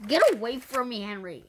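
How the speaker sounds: hissed. A narrator's reading. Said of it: "hissed Claire".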